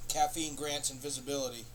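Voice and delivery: usual speaking voice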